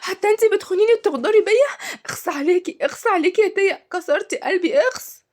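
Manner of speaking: high-pitched, wounded disbelief; hammed-up cry of betrayal; shocked. A narrator's reading. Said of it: "وقالت شهد بصدمة".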